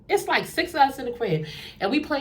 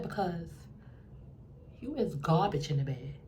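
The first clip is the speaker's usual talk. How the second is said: cold put-down; 5 semitones lower, 11 dB quieter than usual. Retold or imitated. Cold put-down in front of everyone.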